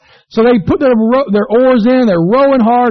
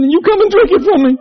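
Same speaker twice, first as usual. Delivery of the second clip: laughing punchline; 11 semitones higher, faster than usual. Retold or imitated. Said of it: "the guy starts sobbing".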